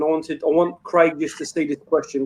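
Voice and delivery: ordinary delivery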